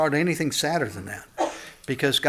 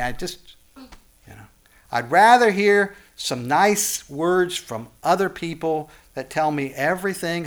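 ordinary talk

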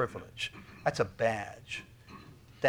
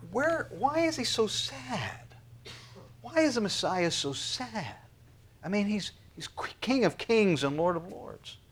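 puzzled listener; 7 semitones higher than usual. Retold or imitated. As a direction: voice a confused churchgoer, lightly. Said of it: "You go".

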